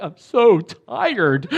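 drawn-out comic whine; exaggerated tiredness. Fasting without caffeine, telling his friend, comically exhausted. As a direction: stretch it slowly into a laughing moan.

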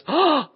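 a loud, high shocked gasp; audible gasp of shock; sharp gasp. Shown as a demonstration. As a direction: suck in air as if scandalised. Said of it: "you probably would have heard gasp".

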